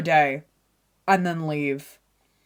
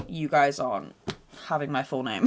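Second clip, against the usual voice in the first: ordinary talk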